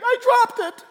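put-on high, timid child voice; small child. Child owning up to parent, high and timid. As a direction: answer like a scared little kid.

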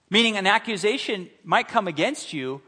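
ordinary delivery

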